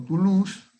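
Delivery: "Toulouse" said the French way, too-LOOZ, stress on the end; French pronunciation. Shown as a demonstration. Teacher who studied there gives the French name, carefully pronounced. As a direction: give "Toulouse" its French sounds. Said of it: "su nombre en francés".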